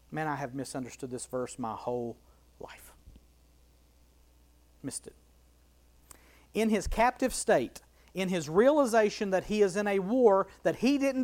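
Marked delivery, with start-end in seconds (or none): 0.1-5.1 hushed, fast confession under the breath; whispered aside. Real, not acted. Preacher confessing a misunderstanding in a whisper. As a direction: breathe it out like a secret.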